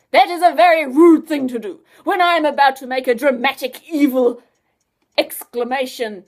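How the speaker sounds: a touch of wounded pride; offended. Acted. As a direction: scientist miffed that Adam stole his line, mock offended. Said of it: "in a very offended voice".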